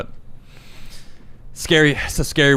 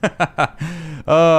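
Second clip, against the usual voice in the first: nervous laugh; 6 semitones higher, 4 dB louder than usual. Real, not acted. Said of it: "I hope I don't get in trouble".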